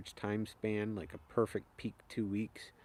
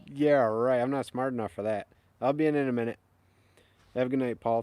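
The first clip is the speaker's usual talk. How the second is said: higher and louder, called to another room; raised voice to the family off camera; calling out; 6 semitones higher, 9 dB louder than usual. Real, not acted.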